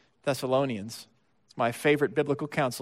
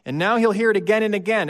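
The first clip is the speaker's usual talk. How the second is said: ordinary talk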